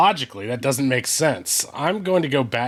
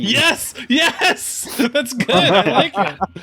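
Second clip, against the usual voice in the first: high, laughing excited yells; delighted laughing cheer; 11 semitones higher, 6 dB louder, much slower than usual. Real, not acted. Delighted reaction to accent.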